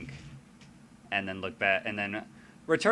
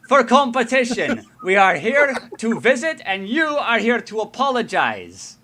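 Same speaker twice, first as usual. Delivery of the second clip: much higher, much louder stern scolding; wife shouting at husband, high and loud; 12 semitones higher, 14 dB louder than usual. Acted. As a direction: give him a furious dressing-down. Noelle scolding husband after smack.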